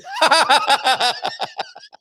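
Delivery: high delighted laugh bursting out; burst of laughter. Real, not acted. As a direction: laugh out loud, high and free. Host laughing at Ian's kettles.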